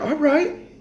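high, eager yes in a put-on voice; mock high agreement. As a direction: agree happily in a squeaky tone. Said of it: "I'm like".

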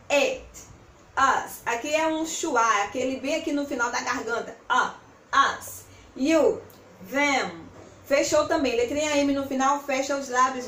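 ordinary talk

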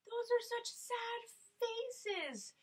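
high, slow pretend sorrow; mock heartbroken. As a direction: ham up being touched. Teacher pretending to be moved.

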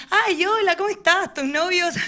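high, bright mock-aunt chirp; aunt's sugary greeting. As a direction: gush it like a nosy aunt. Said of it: "le pregunta a mi otra hermana". (tía empalagosa, voz aguda y cantarina)

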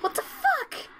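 panicked squeal; scared shriek. Real, not acted. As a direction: shriek in real fright. Ghost attacking in horror game.

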